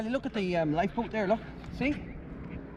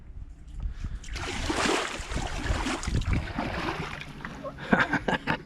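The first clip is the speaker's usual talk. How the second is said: high giggle; 6 dB quieter than usual. Real, not acted. Laughing after making it.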